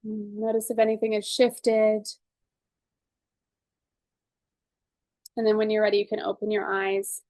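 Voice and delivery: calm, measured guided-session voice; guided tapping. Acted. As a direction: talk them gently through each tapping point.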